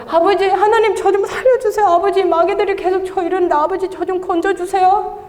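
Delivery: high, whining plea with a laugh in it; mock pleading. Testifier re-enacting her prayer against demons, playful pleading. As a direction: beg father for rescue, hammed up. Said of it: "아버지를 불러요".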